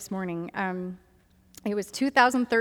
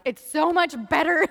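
higher, emphatic gushing; enthusiastic reassurance; 5 semitones higher, 4 dB louder than usual. Retold or imitated. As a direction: gush it to your friends. Acted enthusiastic remark to friends.

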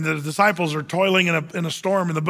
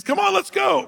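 high, urgent acted call; rally reluctant companions in a raised pitch; eager rallying; 14 semitones higher than usual. Caleb urging his people into the land, eager rallying.

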